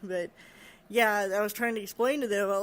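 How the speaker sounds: ordinary talk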